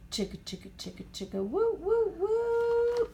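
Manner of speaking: choo-choo train sound, chug then whistle; train chugging and whistle. A narrator's reading.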